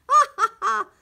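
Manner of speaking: high, loud ha-ha-ha; delighted laugh. A narrator's reading. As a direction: laugh out with glee. Reader delights at the hatchling.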